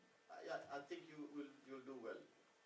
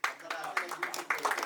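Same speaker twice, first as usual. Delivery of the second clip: calling out the invitation, much louder; 3 semitones higher, 17 dB louder than usual. Real, not acted. Host inviting the women entrepreneurs to exhibit, loud call. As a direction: boom it out so the whole room hears.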